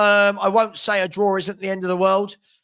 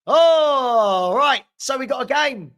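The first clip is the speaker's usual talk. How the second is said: loud, high, drawn-out ALL; big getting-started shout; rallying call; 6 semitones higher, 5 dB louder, slower than usual. Real, not acted. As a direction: stretch ALL out loudly, then snap to RIGHT. Calling stream to order before kickoff.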